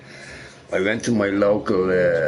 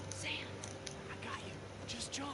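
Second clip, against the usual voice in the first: hushed whisper, far softer; 24 dB quieter than usual. Real, not acted. Reassuring Sam to jump, whispered.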